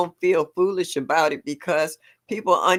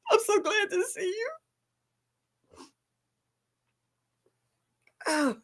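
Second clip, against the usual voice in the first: high, tearful and softer, close to crying; choked up with real joy, voice cracking; teary and emotional; 5 dB quieter than usual. Real, not acted. Streamer moved to tears by her returning viewer, teary. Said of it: "Don't ask me why I'm so emotional tonight".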